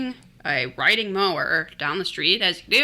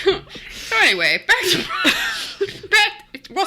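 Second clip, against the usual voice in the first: high, laughing attempt to continue; still laughing; 13 semitones higher than usual. Real, not acted. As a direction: try to go on through laughter. Laughing while resuming.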